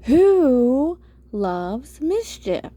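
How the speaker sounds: slow, exasperated demand; insistent yell. A narrator's reading. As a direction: stretch out WHO in exasperation. Narrated boy demands an answer.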